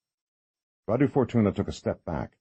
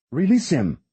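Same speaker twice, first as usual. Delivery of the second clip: louder, higher command; firm demand; 9 semitones higher, 5 dB louder than usual. Acted. Kate at the edge demanding the priest's release, loud. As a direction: demand it with force.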